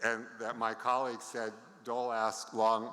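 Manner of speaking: ordinary talk, nothing marked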